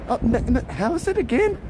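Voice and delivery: high, halting puzzled question; confused stumbling. Retold or imitated. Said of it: "They're like".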